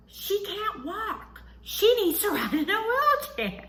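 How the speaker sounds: high, drawn-out mock holler down a hall; imitated nurse yell. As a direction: mimic her calling out, laughing through it. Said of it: "nurse yells from down the hall".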